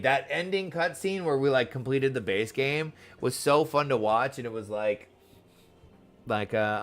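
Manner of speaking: normal talking voice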